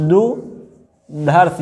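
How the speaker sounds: दुधर्ष stretched out very slowly; दुधर्ष, drawn out; slow dictation. Shown as a demonstration. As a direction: spell out दुधर्ष slowly for writing. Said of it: "ये सही है".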